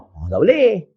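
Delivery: normal talking voice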